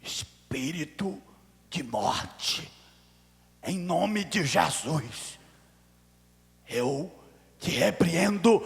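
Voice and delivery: very slow, drawn-out solemn command; low, weighty words with long pauses, swelling at the end; rebuke in the ear. Retold or imitated. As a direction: slowly cast out death, word by word. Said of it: "falei no ouvido assim".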